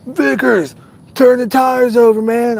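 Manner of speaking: high-pitched mock heckler; imitating egging-on kids. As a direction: call out like kids goading a driver. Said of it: "They'd be like".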